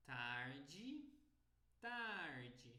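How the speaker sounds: "tarde" drawn out, ending in "djee"; Brazilian pronunciation. Shown as a demonstration. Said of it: "o sotaque brasileiro fala tarde".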